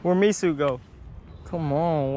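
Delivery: ordinary talk, nothing marked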